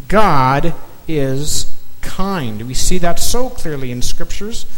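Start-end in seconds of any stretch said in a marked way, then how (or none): 0.1-2.6 God is kind spoken very slowly; slow and weighty, word by word. Shown as a demonstration. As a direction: lay each word down for note-taking. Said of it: "In your notes".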